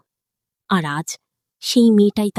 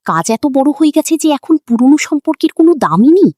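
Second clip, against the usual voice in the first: higher, louder angry accusation; angrier; 6 semitones higher, 5 dB louder than usual. A narrator's reading. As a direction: shout the bitter question. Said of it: "তখন মিরাজ আরও রেগে যায়". (আরও রাগান্বিত কণ্ঠে)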